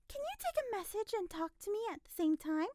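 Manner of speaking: high, prying questioner; nosy woman pressing on; prying old woman. A narrator's reading. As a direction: pursue the question relentlessly. Busybody pursues questions.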